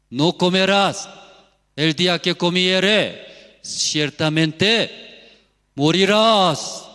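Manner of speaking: loud, high divine warning, last words drawn out; shouted warning. Acted. Preacher voicing God's command to Adam, shouted warning. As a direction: thunder it out slowly at the end.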